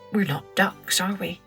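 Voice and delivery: plain reading voice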